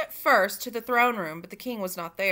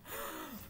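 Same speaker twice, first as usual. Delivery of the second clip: a quick, quiet intake of breath; faint startled gasp; soft shocked gasp; 6 semitones higher, 15 dB quieter than usual. Acted. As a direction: gasp in horror. Gasp after the news.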